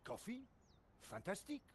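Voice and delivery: hammed-up French sergeant accent; a tempted French soldier; comic French accent, torn. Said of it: "you just get a French accent".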